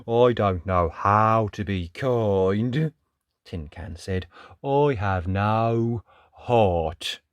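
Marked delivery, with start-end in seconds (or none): 0.1-2.9 slow, drawn-out tin man voice. Acted. Tin Can answering Little Dot, slow tin man voice. 4.6-7.2 very slow, drawn-out tin man. Acted. Tin Can admits having no heart, slow tin man voice.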